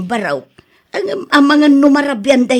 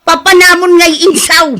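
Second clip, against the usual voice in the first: loud, high call across the yard; 5 semitones higher, 6 dB louder than usual. Acted. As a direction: shout for Insaw to come. Calling out to Insaw angrily. (napigsa nga ayab)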